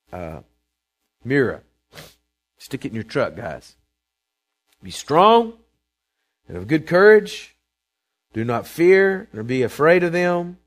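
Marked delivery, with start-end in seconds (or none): 4.8-10.6 loud, high preaching cry; booming scripture call; proclaimed verse. Acted. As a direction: ring it out like a preacher.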